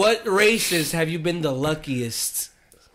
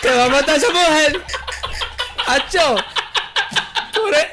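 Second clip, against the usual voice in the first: put-on Puerto Rican accent; 7 semitones higher than usual. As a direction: scold him in Boricua Spanish. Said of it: "how would you say it in Puerto Rican".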